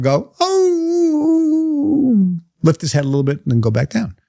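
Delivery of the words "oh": a long, much higher mournful howl; dog howl. Retold or imitated. Old hound dog lying on a nail, howling high. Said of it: "the dog would be howling".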